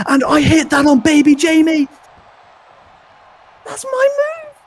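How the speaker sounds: high, loud thrilled shouting; excited yell. Real, not acted. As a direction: cheer like it's your own move. Commentator cheers brother's signature move.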